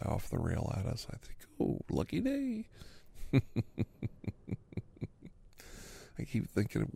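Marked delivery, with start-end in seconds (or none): 1.6-2.7 high-pitched mock celebration; sarcastic little cheer, pitched way up; mock-cheerful relief. Mock relief as trains pass safely. 2.7-5.3 long, breathy real laugh; breathy run of chuckles. Real, not acted. Laughs at own train joke.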